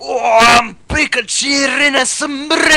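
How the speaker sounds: put-on loud, high shouted Scottish accent. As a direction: bellow it in a hammy Scottish accent. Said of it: "They're demanding Scottish".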